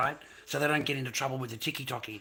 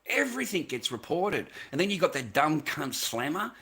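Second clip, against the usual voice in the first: ordinary talk, nothing marked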